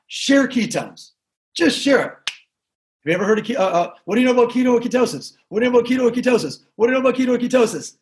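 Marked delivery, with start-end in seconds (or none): 4.1-8.0 fast, relentless repeated question; rapid repeated pitch. Shown as a demonstration. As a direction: rattle it off like a server going table to table. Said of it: "That's all I need you to do".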